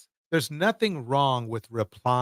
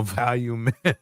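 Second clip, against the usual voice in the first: laughing; 4 semitones lower than usual. Real, not acted. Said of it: "I can't even say it with a straight face".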